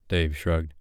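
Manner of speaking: plain reading voice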